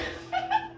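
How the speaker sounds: quick high-pitched giggle; little squeak of a laugh. Real, not acted. Laugh after okay.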